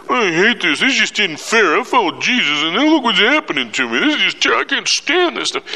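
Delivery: high, petulant complaining voice; mock whiny apostle. Paul imagined grumbling to Roman guards, high whine. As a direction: whine it like a sulking prisoner. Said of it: "the New Testament apostle going".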